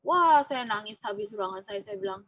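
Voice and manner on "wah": ordinary talk, nothing marked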